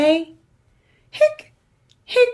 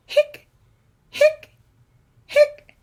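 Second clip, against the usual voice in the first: high squeaky hic hic hic; squeaky hiccups; slower than usual. Acted. Skeleton hiccuping to the kids, high squeaky hics.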